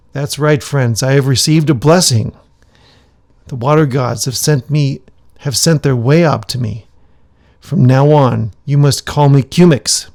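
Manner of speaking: loud, booming announcement; grand proclamation. Acted. Shaman declaring his new name loudly to the gathered villagers.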